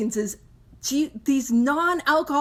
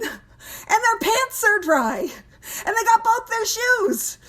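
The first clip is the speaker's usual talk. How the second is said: amazed disbelief; 8 semitones higher than usual. Real, not acted. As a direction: marvel at it like it's a miracle. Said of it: "It is mind-boggling".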